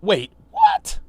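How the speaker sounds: high, sharp jump of shock; stunned disbelief. Real, not acted. Streamer reacting to the rapper's answer, shocked disbelief. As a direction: caught completely off guard.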